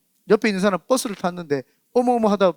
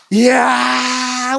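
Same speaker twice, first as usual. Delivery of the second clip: long drawn-out amazed "이야", a bit louder; awed exclamation; 4 semitones higher, 6 dB louder, much slower than usual. Retold or imitated. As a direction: let the wonder stretch out as the walls fall. Walls fall, awed exclamation.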